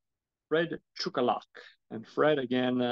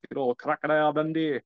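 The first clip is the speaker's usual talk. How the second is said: a louder, hammed-up Aussie accent; bad mock Australian accent; fake Australian accent; 3 semitones higher, 5 dB louder than usual. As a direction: butcher an Australian accent on purpose. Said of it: "I really butchered that Australian accent".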